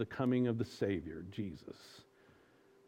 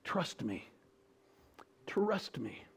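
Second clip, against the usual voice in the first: soft unvoiced whisper; tender divine whisper; God whispering; 8 dB quieter, slower than usual. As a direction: hush them gently, trust me. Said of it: "God says".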